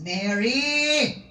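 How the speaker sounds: loud tender call of a name. Acted. Jesus calling Mary by name, loud call. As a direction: call her name across the garden.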